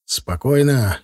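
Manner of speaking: ordinary delivery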